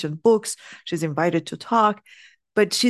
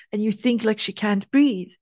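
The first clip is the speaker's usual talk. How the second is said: ordinary talk, nothing marked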